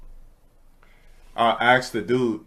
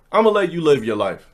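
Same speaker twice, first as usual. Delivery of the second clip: normal talking voice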